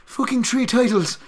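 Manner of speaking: "three" as "tree" in high-pitched pidgin; Hawaiian pidgin accent. Shown as a demonstration. Showing how Hawaiians say three, high pidgin accent. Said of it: "we pronounce three the same way the Irish do".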